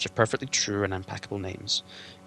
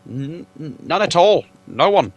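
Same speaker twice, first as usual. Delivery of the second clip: stuttering, higher flustered hobbit; flustered stammer; 7 semitones higher, 11 dB louder than usual. Acted. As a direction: trip over the words in panic. Bilbo caught stammering.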